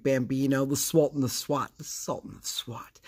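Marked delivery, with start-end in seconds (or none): 1.8-3.0 hushed whisper, fading out; whispered echo. Shown as a demonstration. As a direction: breathe the nickname back softly. Nickname echoed in a whisper.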